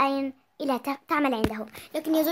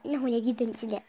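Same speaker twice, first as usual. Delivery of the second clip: ordinary delivery, nothing marked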